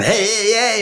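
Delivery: high, drawn-out glad-handing "hey"; showy, high-pitched put-on greeting; hammed-up salesman greeting. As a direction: mimic an over-eager natural salesman. Mimics born expressive salesperson.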